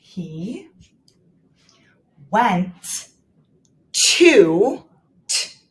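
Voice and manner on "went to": "went" drawn out long; teacher modelling "went to"; stretched word. Shown as a demonstration. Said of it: "Let's do it again".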